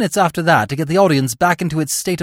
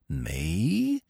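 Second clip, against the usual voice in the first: feigned innocence; 9 semitones lower, 10 dB quieter than usual. A narrator's reading.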